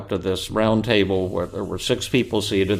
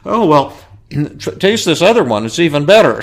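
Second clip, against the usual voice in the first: much higher and loud, delighted; eager offer; 7 semitones higher, 9 dB louder than usual. Retold or imitated. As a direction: press another dish on him. Diners eagerly pushing another dish.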